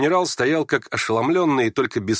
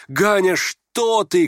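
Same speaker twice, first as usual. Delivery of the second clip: high, drawn-out alarmed cry; 9 semitones higher, 5 dB louder, much slower than usual. Acted. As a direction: call out to stop your son. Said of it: "крикнула Нина Александровна". (встревоженный материнский окрик)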